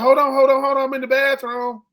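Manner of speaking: mock shout of a man buying time; hammed-up call from the bathroom; mock yelled stall. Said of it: "he kept saying".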